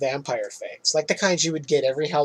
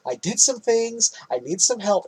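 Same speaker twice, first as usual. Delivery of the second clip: mildly hammed-up plea for help, pitched up; desperate plea; 5 semitones higher than usual. Man calling his attorney at the end, imitated desperate plea. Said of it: "desperately reaching out".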